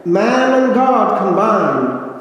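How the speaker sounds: slow, loud, ringing declaration; echoing proclamation. Retold or imitated. Recalling words echoing at his ordination, slow loud ringing. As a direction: let it ring out as if echoing through a church. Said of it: "I heard echoing through the air".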